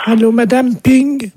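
high put-on wife voice; old man's wife. Wife takes over the phone from her husband, high put-on voice. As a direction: pipe up as the elderly wife. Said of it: "C'est ma femme".

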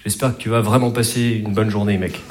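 low, softened heartfelt wish; tender man-to-man sincerity, put on; mock sincerity. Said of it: "il te dit rarement".

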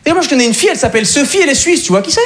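high, eager dumb tourist voice; excited French guy. Retold or imitated. French guy in Calais gushing about Sophie, high eager voice. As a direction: gush like a clueless fan. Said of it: "il me fait".

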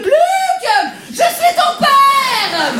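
high, slow, loud squeaky Vader shout; Darth Vader line. Pretend. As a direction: boom out the Vader line but it comes out high. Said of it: "pour doubler Dark Vador". (Dark Vador, crié lentement d'une voix aiguë)

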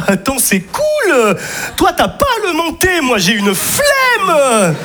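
chirpy and clueless, dragging it out; mock cheery friend. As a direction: pal at the stairs, slow cheery mock comfort.

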